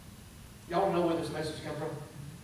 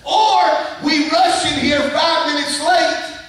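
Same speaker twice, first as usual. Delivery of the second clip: loud, high-pitched sermon delivery; impassioned, raised preacher's voice; full-force preaching; 10 semitones higher, 14 dB louder than usual. Real, not acted. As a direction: belt it out to the whole congregation.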